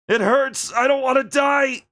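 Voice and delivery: high, loud shouted pain and terror; pained, desperate cry. Acted. Wounded Billy shouting to anyone listening, desperate. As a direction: shout through the pain, begging to live.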